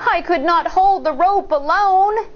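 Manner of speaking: high, mock-feeble little girl; pretending weakness. A narrator's reading. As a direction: fake being too weak. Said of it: "Shang pretended".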